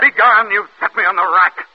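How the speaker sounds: furious dismissal; angry outburst. Acted. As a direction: drive him away in fury. Othello rages at Iago.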